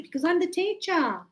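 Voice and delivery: ordinary talk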